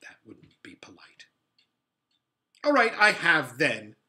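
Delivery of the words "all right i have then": loud, defiant outburst; much louder. A narrator's reading. Boy snapping back at Polly, loud. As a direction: blurt it out loud, not caring who hears. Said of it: "in a much louder voice".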